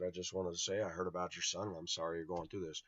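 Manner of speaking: low, soft sympathy; retold condolence. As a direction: offer condolences gently and quietly. Retold condolence at neighbor's door.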